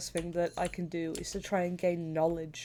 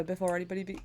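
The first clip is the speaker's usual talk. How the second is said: ordinary delivery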